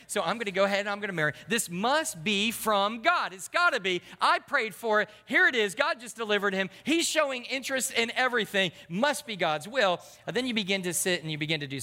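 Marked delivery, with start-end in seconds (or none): 0.1-10.0 higher and louder, gushing over a so-so guy; hammed-up eager single convincing herself; mock giddy certainty. Preacher mocking an eager single, gushing mock excitement.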